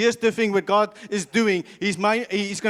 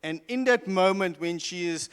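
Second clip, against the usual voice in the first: ordinary delivery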